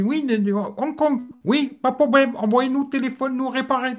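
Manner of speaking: high-pitched, broken French in a put-on Chinese accent; mock Chinese accent. Hong Kong after-sales clerk answering a customer, mock Chinese accent. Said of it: "Mais l'accent chinois, vous avez caché ça".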